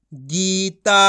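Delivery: गीता with both vowels held; drawn-out long vowels. Shown as a demonstration. Teacher models the joined word, vowels drawn out. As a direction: stretch गीता out syllable by syllable. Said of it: "खींच के पढ़ेंगे".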